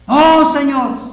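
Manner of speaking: loud, high, drawn-out "oh"; shouted protest. Acted. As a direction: cry out in protest to your master. Peter protests the prediction.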